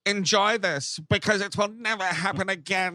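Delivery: mock stern German-accented warning; Til Schweiger. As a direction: imitate a haughty German driver. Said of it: "This is a great impression".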